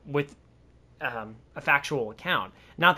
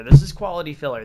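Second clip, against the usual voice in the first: much louder and faster, proclaiming it; loud emphatic declaration; 10 dB louder, faster than usual. Shown as a demonstration. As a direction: announce it loudly like a slogan. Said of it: "Let's just put that out there".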